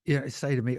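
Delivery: ordinary talk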